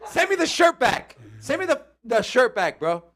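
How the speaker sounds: high, loud playful demand; mock outrage. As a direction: pretend to be betrayed, voice jumping way up. Mock outrage after being teased.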